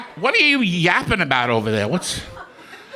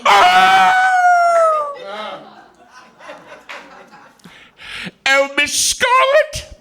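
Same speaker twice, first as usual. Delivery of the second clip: high, loud, crying Stan Laurel whimper as the maid; Stan Laurel as Prissy; 3 dB louder, slower than usual. Acted. Comic doing Stan Laurel as Prissy the maid, high wailing cry. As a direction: wail it like Laurel playing Prissy. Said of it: "I'll do Stan Laurel as Prissy the maid".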